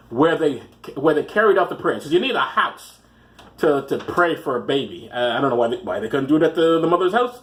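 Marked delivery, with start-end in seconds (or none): none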